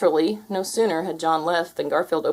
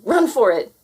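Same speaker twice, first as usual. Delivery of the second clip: urgent shout; 5 semitones higher, 6 dB louder than usual. Acted.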